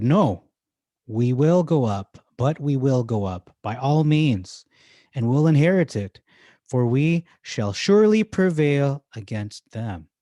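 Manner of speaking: loud, slow, commanding declaration; rally the crowd with firm authority. Acted. Caleb silencing the frightened people with loud authority. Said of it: "He has to say this ... with authority".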